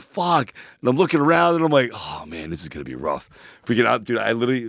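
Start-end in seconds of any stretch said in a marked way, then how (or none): 0.0-0.5 panicked curse. Retold or imitated. Feels the acid kick in. 1.9-3.3 quiet dread. Retold or imitated. Dreading the coming trip.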